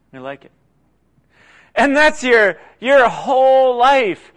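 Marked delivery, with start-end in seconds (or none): 0.1-0.5 softly repeated. Acted. Repeats line much softer.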